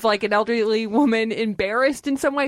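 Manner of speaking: ordinary delivery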